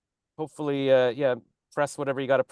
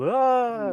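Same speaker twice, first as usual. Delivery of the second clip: short high fake scream; mock scream; 12 semitones higher, 4 dB louder than usual. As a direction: yelp in pretend horror.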